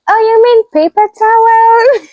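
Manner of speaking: loud, very high exaggerated realisation; hammed-up imitation of getting it; mimicked sudden understanding. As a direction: shoot the pitch up as if it finally clicks. Mimics listeners finally understanding.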